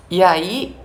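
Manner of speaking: ordinary delivery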